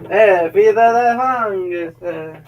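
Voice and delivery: put-on big, high Italian accent; mock Italian accent. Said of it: "to, like, Italian".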